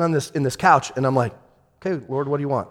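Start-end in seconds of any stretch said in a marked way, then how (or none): 1.8-2.7 quiet patient ask. Shown as a demonstration. Pastor showing how he asked God on the couch, quiet. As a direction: ask once and let it hang. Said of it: "And just stay silent".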